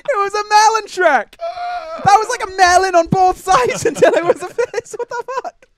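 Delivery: high, loud disbelieving protest; incredulous outburst. Real, not acted. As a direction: wail in comic disbelief. Incredulous outburst over game death, laughing.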